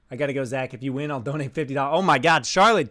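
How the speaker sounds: normal talking voice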